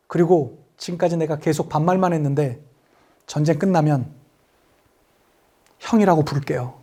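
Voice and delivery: low, understated promise; lightly acted quiet aside to the men; 이재훈's film role. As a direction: drop from commander to younger brother. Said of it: "젊은 이재훈이 나와가지고".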